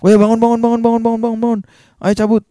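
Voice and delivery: high, rapid-fire panicked rousing; urgent wake-up call. Retold or imitated. Narrator to his sleeping friend at the villa, urgent wake-up call. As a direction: rattle it out, pitched high, to rouse a sleeper.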